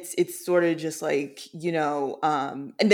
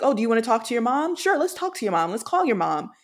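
quick, higher-pitched coaxing offer; pitched-up, breezy mock helpfulness; lightly acted therapist; 6 semitones higher than usual. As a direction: lightly voice a therapist humouring a patient. Retold therapist offering a call.

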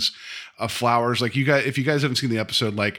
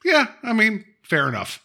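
sarcastic agreement; 9 semitones higher than usual. Retold or imitated. Mock reply to Nazi line.

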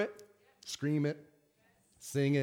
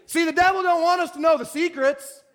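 ordinary talk

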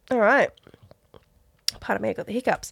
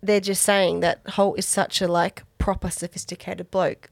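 ordinary talk, nothing marked

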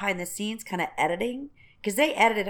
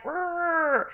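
mock growl; 8 semitones higher than usual. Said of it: "they'd be like".

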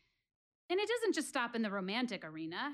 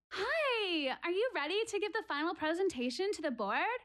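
chirpy coworker; 7 semitones higher than usual. Acted. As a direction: play the overly cheerful office colleague. Coworker greets her at work.

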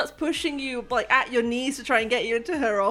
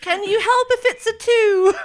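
high voice; 11 semitones higher, 7 dB louder than usual. Acted. GM asks, high voice.

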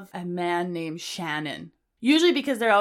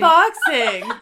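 laughing; 6 semitones higher, 9 dB louder than usual. Real, not acted. Laughing disbelief.

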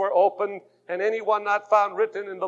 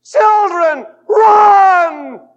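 high, loud, panicked cry; mother shrieking at her children to run; horrified shout; 12 semitones higher, 14 dB louder, much slower than usual. Retold or imitated. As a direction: yell it like a mother seeing danger. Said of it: "she shouted".

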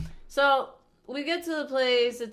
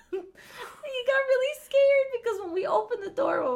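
high, squeaky real laughter through words; laughing while talking; 13 semitones higher, 4 dB louder than usual. Real, not acted. Telling friends how the kid got scared, laughing. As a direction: struggle to talk through giggles.

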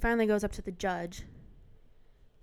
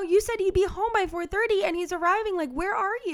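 loud, much higher, fast scolding from a mother; mom scolding; 11 semitones higher, 7 dB louder than usual. Retold or imitated. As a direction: let the frustrated mom tear into her son. Mom scolding son on phone.

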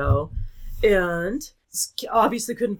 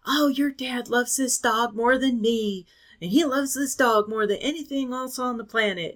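complaining stepmom; 5 semitones higher than usual. Retold or imitated. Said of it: "had to hear for years from my stepmom".

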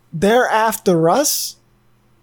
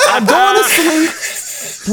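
laughing; 6 semitones higher, much slower than usual. Real, not acted. Laughing after the outburst.

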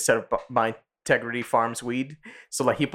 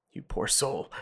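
quieter pitying retort; mock pity; 3 semitones lower, 7 dB quieter, slower than usual. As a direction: imitate hushed, low pity. Retold pitying reply from friends.

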